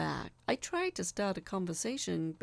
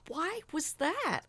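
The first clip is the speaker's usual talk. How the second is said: anxious; 10 semitones higher, slower than usual. A narrator's reading. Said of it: "close to hyperventilating".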